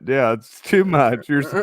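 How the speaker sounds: usual speaking voice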